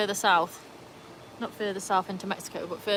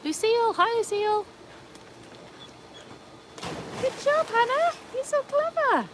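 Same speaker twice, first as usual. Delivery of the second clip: pet voice. Real, not acted. Cooing to pets and viewers.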